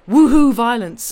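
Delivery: big loud whoop; loud cheer. Real, not acted. Streamer cheering the revolution, loud whoop.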